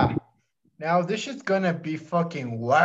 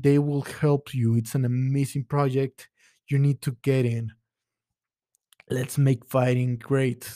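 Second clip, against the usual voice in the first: ordinary delivery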